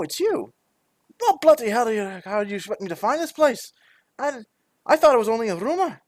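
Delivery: high, startled doorkeeper voice; flustered man at the door. Acted. Calling to visitors at the door, flustered.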